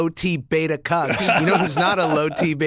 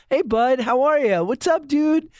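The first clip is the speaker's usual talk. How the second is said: put-on higher, excited buddy greeting to a kid; 8 semitones higher than usual. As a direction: greet the kid like a pal, pitched up. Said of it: "Give him a buddy. Give him a pal".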